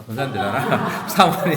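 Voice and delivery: laughing through the words; amused laughing. Real, not acted. Pastor joking over a member's title, laughing. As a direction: chuckle while correcting yourself.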